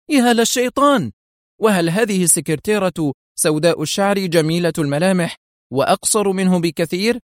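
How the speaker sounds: sudden high outburst, cursing; cutting shout. A narrator's reading. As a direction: cut him off with a shocked cry. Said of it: "قطعه حييم صائحا". (صيحة مفاجئة عالية تقاطع جوزيف)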